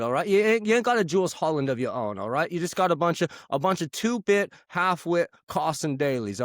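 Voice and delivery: put-on swaggering Manchester lad accent. Host ranting at Yank listeners in Manchester accent. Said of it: "I am joined today by [name] from Oasis".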